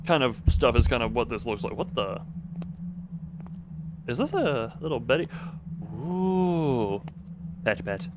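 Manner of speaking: ordinary talk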